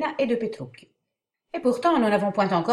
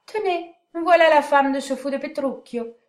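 moquerie imitée; 7 semitones higher, 4 dB louder than usual. Acted. Catarina imite les moqueries du monde.

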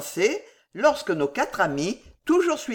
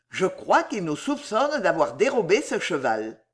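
usual speaking voice

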